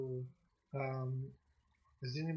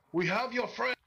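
high, loud shouted threat; goblin yell; 8 semitones higher, 4 dB louder than usual. Acted. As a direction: yell it out across the room. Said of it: "a goblin, uh, yell out in common".

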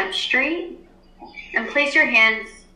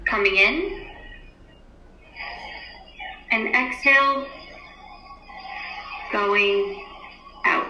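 guided meditation. Acted. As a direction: ease the class into stillness. Teacher leads class meditation.

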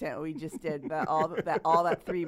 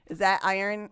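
usual speaking voice

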